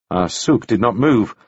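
plain reading voice

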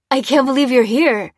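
high, loud delighted disbelief; excited greeting to a found friend. A narrator's reading. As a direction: say it thrilled, voice lifting. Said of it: "he said excitedly".

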